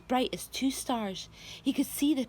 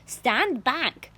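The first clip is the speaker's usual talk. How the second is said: louder warning shout; 3 semitones higher, 7 dB louder than usual. A narrator's reading. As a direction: bark the warning out. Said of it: "Aunt Sponge shouted".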